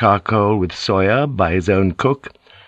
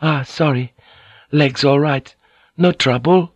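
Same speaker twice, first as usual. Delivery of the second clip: high, thin apologetic plea; pleading; 6 semitones higher than usual. A narrator's reading. Fujiko insists her legs are fine, pleading. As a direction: plead politely in a high woman's voice. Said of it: "she pleaded".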